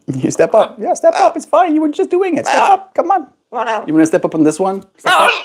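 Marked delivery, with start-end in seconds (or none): 0.1-2.4 squeaky coaxing voice way up high; high singsong coaxing to a parrot; high pet talk. Real, not acted. Coaxing the bird onto shoulder. 2.4-2.9 very high coaxing voice; high singsong pet voice; high pet talk. Real, not acted. Coaxing the bird onto shoulder. 2.9-3.3 squeaky coaxing voice way up high; high singsong coaxing to a parrot; high pet talk. Real, not acted. Coaxing the bird.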